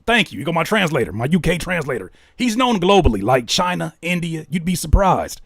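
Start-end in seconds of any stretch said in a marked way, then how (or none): none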